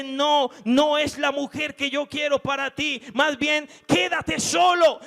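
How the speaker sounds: loud, high shouted command; God's firm answer. Acted. God refusing the believer's choice, shouted firm no. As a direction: put your foot down as God.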